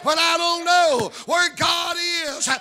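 high, loud preacher's cry, slowing on the last line; full-force preaching. Real, not acted. Preacher assuring the congregation that God knows, full-force preaching. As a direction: proclaim it triumphantly, drawing out the ending.

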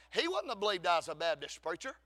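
normal talking voice

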